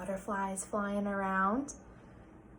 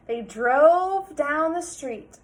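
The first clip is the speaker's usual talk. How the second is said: slow dread; 4 semitones higher, 7 dB louder, much slower than usual. A narrator's reading. Suspense narration.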